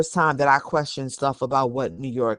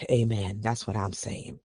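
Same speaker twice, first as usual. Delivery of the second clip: ordinary talk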